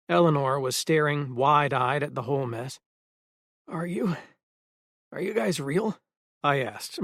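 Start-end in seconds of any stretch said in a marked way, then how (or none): 3.7-6.0 hoarse, serrated. A narrator's reading. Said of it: "my voice hoarse and serrated".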